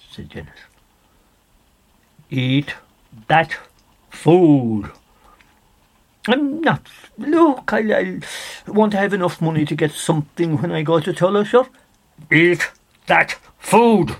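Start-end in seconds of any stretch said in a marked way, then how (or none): 2.3-4.9 loud, word-by-word stern order; stern command. Acted. Stranger orders Dennis to eat. 6.3-11.7 higher, louder, fast nervous pleading; anxious refusal. Acted. Dennis nervously refuses the food. 12.3-14.2 loud, very slow, looming command; menacing third order. Acted. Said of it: "said the fella a third time".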